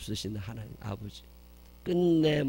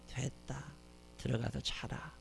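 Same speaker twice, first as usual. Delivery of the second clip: much softer, gentle murmur; hushed tender father; 11 dB quieter than usual. Acted. The father embracing his son, very soft and tender. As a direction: hold him and say it softly.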